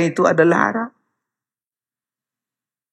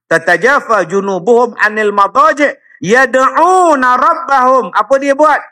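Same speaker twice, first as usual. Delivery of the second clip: raised, higher preaching voice; fiery preaching; 9 semitones higher than usual. Acted. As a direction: preach it out to the hall with full force. Opening preaching at full force. (berceramah dengan suara lantang dan tinggi)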